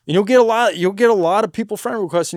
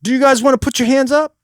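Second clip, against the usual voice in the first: raised-pitch stage question; politely poll the audience, pitched up; asking the crowd; 6 semitones higher, 3 dB louder, faster than usual. Shown as a demonstration. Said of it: "Don't do that".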